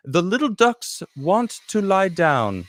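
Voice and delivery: drawn-out, hammed-up reading of a ditty; lightly put-on singsong delivery; silly ditty voice. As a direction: stretch it out in a slow put-on voice. Reading chat's ditty in funny voice.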